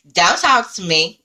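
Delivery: high, loud curt brush-off; curt snap. Retold or imitated. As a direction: shut her down hard. Thief snaps back.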